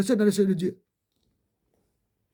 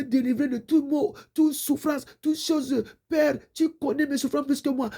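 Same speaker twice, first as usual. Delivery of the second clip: raised, pleading prayer voice; fervent prayer; 4 semitones higher than usual. Acted. Preacher praying aloud to God, fervent and pleading. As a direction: pour it out to God, humble and urgent.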